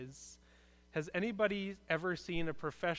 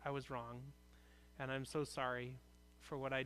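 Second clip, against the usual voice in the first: softer, gentle admission; softly sincere; 3 semitones lower, 8 dB quieter than usual. Shown as a demonstration. Pastor models a heartfelt apology to a friend, soft and sincere. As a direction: confess it quietly and honestly.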